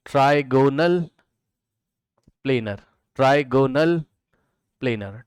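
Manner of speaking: Trigonal, long pause, then planar; teacher spelling out trigonal planar word by word; slow dictation. Shown as a demonstration. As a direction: draw out trigonal syllable by syllable and set planar apart. Teacher dictates new term slowly.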